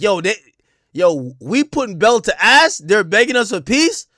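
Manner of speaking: mock brag of winning; loud gloating. [name] bragging to his crew, loud mock gloat.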